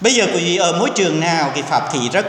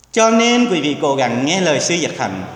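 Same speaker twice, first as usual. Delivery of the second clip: ordinary talk, nothing marked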